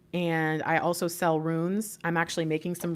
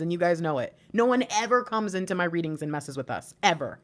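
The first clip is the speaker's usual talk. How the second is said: usual speaking voice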